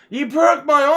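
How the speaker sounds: high, loud mock complaint; accusing injured kid. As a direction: imitate a hurt boy's loud accusation. Retold injured boy's accusation.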